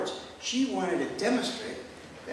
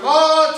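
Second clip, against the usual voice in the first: high, louder yell of pain; 9 semitones higher, 14 dB louder than usual. Retold or imitated. Patient crying out as the squeeze starts, yell. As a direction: cry out in pain. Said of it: "the yell goes from".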